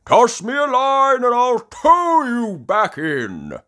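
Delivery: hearty high, loud holler; Grampy Rabbit. Acted. Grampy Rabbit offers stranded sailors a tow, hearty holler. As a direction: shout the offer across the water. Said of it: "says Grampy Rabbit".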